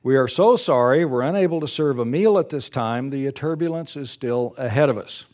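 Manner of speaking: reads the story aloud to the congregation; paced storybook narration, lifting the drama; story reading. Acted. Preacher reading a story to his congregation, in a storytelling voice.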